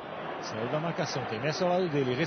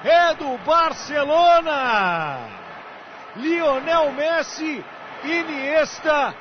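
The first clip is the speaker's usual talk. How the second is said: roared, drawn-out goal call; explosive goal commentary; 8 semitones higher, 8 dB louder, much slower than usual. Acted. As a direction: celebrate the goal loudly. (narração de gol explosiva)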